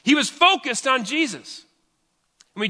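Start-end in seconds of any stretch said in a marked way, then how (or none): 0.0-1.7 focused pushed high and slow; emphatic. Real, not acted. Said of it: "took his eyes off Jesus".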